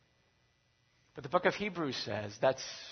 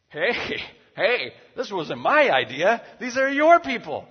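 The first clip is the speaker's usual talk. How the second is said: loud, high, slow protest; defensive shout; 9 semitones higher, 14 dB louder than usual. Acted. Moses answering God in the quarrel, much louder and higher. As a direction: push the blame back hard. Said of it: "And Moses says".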